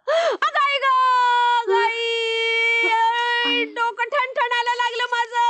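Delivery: slow, drawn-out sing-song moaning; wailing. Acted. Mother-in-law moaning about her throbbing head, drawn out. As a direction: moan in mock pain, stretching the words.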